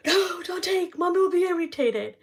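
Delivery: put-on high, loud, rushed kid-sister warning; panicked little sister. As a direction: squeak it out like a worried younger sister.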